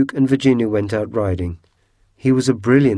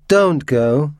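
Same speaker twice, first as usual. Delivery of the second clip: higher, mildly urgent plea; pleading; 5 semitones higher, 4 dB louder than usual. A narrator's reading. Ghost begging the girl as she leaves, pleading. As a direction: beg her to stay.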